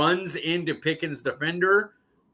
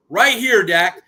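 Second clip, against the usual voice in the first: higher, louder imitated shout for the pass; mock call for the ball; 4 semitones higher, 7 dB louder than usual. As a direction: call out to the quarterback like a receiver begging for it. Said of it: "he sits there and goes".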